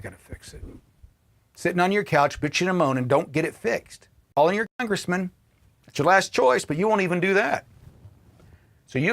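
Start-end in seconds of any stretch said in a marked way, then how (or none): none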